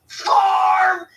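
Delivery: high, loud yelled catchphrase; shouted catchphrase. Pretend. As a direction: bellow it like a TV farmer. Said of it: "I'd just be like".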